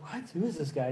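low, hushed aside to himself; quiet inner-thought voice; puzzled aside. Retold or imitated. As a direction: think it softly under the talk, baffled. Acted private puzzled reaction aside.

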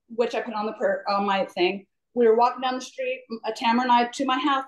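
ordinary talk

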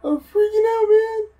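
very high, loud panicked squeal; mock panic. Player to co-player in the dark game, mock panic. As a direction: squeal it like a hammed-up freak-out.

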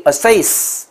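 slow teacher's model of assess; long vowel. Shown as a demonstration. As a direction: draw out the stressed vowel in assess. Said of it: "是也拉长音".

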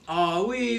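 ordinary delivery, nothing marked

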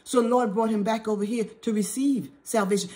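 ordinary talk

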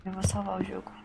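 "Eu vou salvar o jogo" in a breathy whisper. Real, not acted. Player whispering her plan to save the game. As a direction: whisper it to yourself. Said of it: "repeats it whispered".

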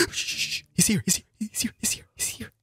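hushed urgent whisper. Real, not acted. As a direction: whisper so he can't hear. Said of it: "Why are we whispering?".

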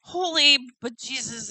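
normal talking voice

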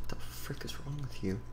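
whisper. Acted. Whispered insult.

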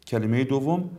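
ordinary delivery

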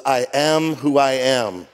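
slow, weighty I am who I am; God's slow declaration. Acted. God answers Moses, slow and weighty. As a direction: speak it slowly as God. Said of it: "And God said to Moses".

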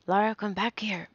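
loud motherly command; urgent yell after her; shouted order. A narrator's reading. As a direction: shout to call a runaway child back. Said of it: "Her mother shouted".

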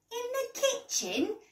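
high-pitched, disbelieving echo; incredulous. A narrator's reading. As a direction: question it as if it were absurd. Dad cannot believe Daisy.

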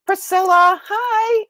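excited greeting. Real, not acted. Greeting viewer.

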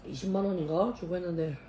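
chewing while talking; talking through food; mouth full. Real, not acted. Seen 2: Food taken at -1.0; lips closed, puffed, chewing while speaking.

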